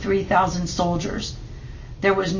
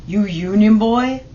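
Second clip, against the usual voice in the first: jeering; 2 semitones higher, 5 dB louder than usual. A narrator's reading. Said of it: "one jeered".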